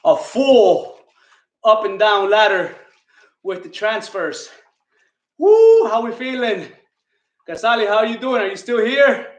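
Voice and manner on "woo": high, loud "woo" of relief; sharp high post-workout woo; winded whoop. Real, not acted. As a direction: whoop out a big breath after exertion. Recovering after the workout round.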